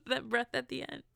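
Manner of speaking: high giggle running into the words; laughing. Real, not acted. Podcaster amused by her own singing, laughing. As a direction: talk through a laugh.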